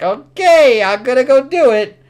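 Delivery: high, loud voice of an eager servant; servant's voice. Retold or imitated. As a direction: answer the king high and loud, a put-on voice. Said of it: "He's like".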